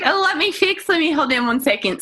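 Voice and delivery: put-on very high, hurried New Zealand accent. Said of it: "I gotta bring it back down".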